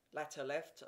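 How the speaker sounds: plain reading voice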